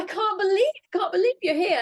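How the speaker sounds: high, loud starstruck gushing; imitated excited fan. Fan meets a TV presenter, excited imitation. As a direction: mimic a thrilled fan, pitched right up.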